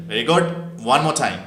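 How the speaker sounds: ordinary talk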